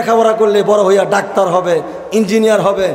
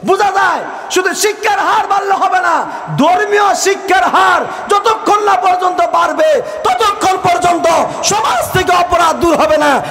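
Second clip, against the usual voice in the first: fiery preaching; 11 semitones higher than usual. Acted. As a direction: rouse the crowd and demand they answer back. Preaching at full force.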